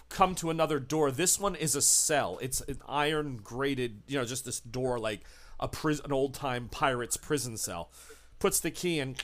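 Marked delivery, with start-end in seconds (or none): none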